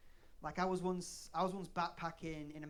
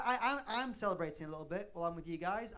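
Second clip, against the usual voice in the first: ordinary talk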